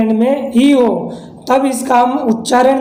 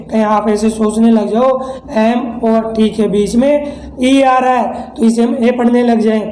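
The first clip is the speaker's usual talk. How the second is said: ordinary talk, nothing marked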